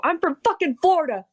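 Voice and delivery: high, fast character voice; quoting a character. Retold or imitated. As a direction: rattle it off in a cartoon voice. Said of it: "That's the best quote in the show".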